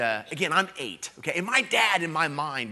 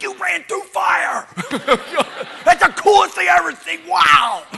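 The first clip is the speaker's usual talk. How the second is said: put-on much higher, loud childlike shout of wonder; excited kid shout; 15 dB louder than usual. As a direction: yell it like an amazed eight-year-old. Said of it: "and I was like".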